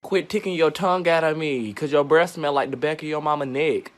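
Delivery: usual speaking voice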